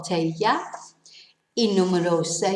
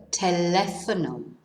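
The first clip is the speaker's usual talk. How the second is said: ordinary talk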